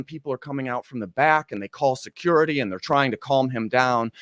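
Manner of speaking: usual speaking voice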